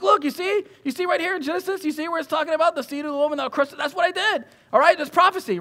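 eager, high-pitched teacher pointing things out; high, insistent, enthusiastic explaining; Jesus. Retold or imitated. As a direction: act out excitedly walking pupils through a text. Acting Jesus eagerly showing disciples scripture.

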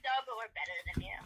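ordinary talk, nothing marked

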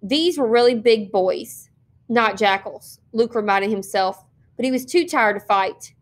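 usual speaking voice

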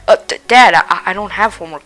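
usual speaking voice

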